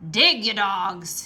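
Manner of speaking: slow, loud barked order; captain commands his men to dig; pirate captain shout. A narrator's reading. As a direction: bark the order at the crew. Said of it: "he shouted".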